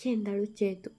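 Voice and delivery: plain reading voice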